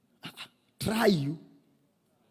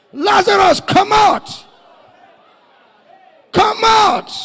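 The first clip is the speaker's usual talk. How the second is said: high, long, roaring call; commanding shout; 11 semitones higher, 10 dB louder, slower than usual. Acted. Jesus calls dead Lazarus out of the tomb. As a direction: call him out of the grave.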